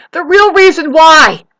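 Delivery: high, slow, loud emphasis; angry shout. Real, not acted. Lecturing neighbors on their motives, loud angry shout. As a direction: raise the voice and stretch it out.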